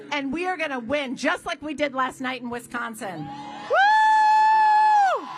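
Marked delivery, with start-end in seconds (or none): 3.7-5.3 high loud cheering woo; rally whoop. Real, not acted. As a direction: whoop up the crowd.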